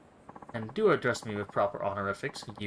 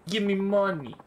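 higher and louder, pushing hard; louder insistent demand; 7 semitones higher, 5 dB louder than usual. Acted. Thug pressing the noble again, louder demand. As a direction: insist on the money this time.

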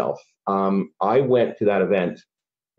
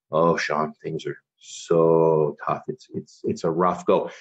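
lower, slow and heavy-hearted; weary defeated sigh; 3 semitones lower, slower than usual. Retold or imitated. Father tells son over a visit, low and weary. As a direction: sound like the weight of money worries is on you. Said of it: "his shoulders would fall".

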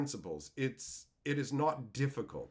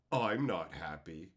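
slow, low imitation of a sulker; mock sulky; 11 semitones lower, slower than usual. Rip-off artist griping about a fair deal, mock sulky. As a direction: drag it out low, mocking a sore loser.

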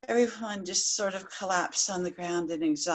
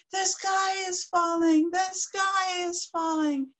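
stampede cry; 8 semitones higher, slower than usual. Acted. Stampede of animals crying.

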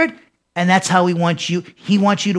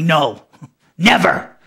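slow, lower "No", then a separate, decisive "never"; emphatic; 4 semitones lower, slower than usual. Shown as a demonstration. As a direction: give "No" and "never" each full weight, with a small laugh between. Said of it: "to say something emphatically".

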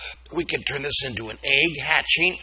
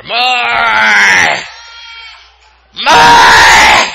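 monster roar; 8 semitones higher, 18 dB louder than usual. Pretend. Breakfast monster roaring to mom for more cereal. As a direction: bellow like a hungry monster.

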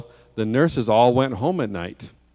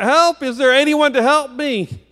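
high, louder plea for rescue; crying out for help; 12 semitones higher, 4 dB louder than usual. A narrator's reading. Missionary with ants under his bandages, crying out for help. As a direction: call out pleadingly, pitched far up. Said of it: "And he cried out".

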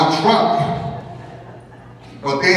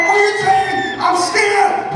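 panicked high outcry; slower than usual. Retold or imitated. A preacher yelling his panic back at God. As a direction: cry out like a terrified child.

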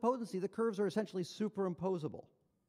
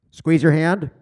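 much louder, firm prompt; insistent machine command; 3 semitones lower, 19 dB louder, slower than usual. Retold or imitated. Machine repeats its prompt to unresponsive patient, loud command. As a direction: imitate the device raising its volume sharply. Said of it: "it's very emphatic".

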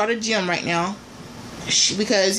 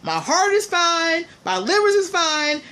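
exasperated; 8 semitones higher than usual. Real, not acted. Exasperated rant about her conditions.

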